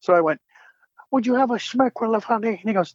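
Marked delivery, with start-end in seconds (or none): 1.1-2.5 high, cheesy Aussie mouse voice; Monterey Jack voice. Shown as a demonstration. Fan asks for the voice, so he does Monterey Jack. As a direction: slip into the old cartoon mouse. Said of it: "Will you do the voice?".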